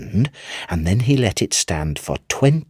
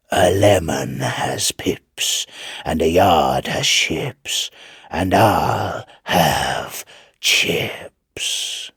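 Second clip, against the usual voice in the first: slow, whispered, eerie devil voice; devil's rhyme; 3 semitones lower, much slower than usual. Acted. Devil tempting young Chips in the dock, slow eerie whisper. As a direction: hiss the rhyme out slowly like a sly devil.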